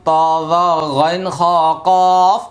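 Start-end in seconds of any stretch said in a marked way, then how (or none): 1.9-2.4 কফ punched louder; heavy letter drill. Shown as a demonstration. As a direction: stress কফ as a thick, heavy letter. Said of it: "মুখস্থ করার জন্য".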